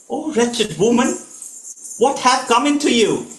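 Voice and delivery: high, pained cry of disbelief; anguished king. Acted. King to his wife, high anguished disbelief. Dashrath stunned, pleading with Kaikeyi.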